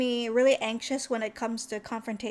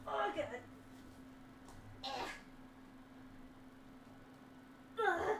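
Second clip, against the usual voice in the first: soft straining grunts and words; real physical strain; 17 dB quieter than usual. Real, not acted. Streamer doing a push-up for viewers, quiet straining. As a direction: squeeze words out through a push-up.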